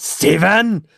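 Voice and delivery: sharp high scolding call of a name; scolding yell. Retold or imitated. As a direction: call him out loud and sharp. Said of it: "the first thing our chat heard was".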